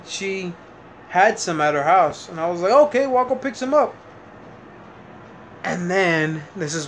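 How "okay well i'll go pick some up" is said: lightly raised, easygoing offer; breezy high agreeable. Retold or imitated. Retelling offer to girlfriend, lightly high and breezy. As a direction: brush it off casually, pitched up.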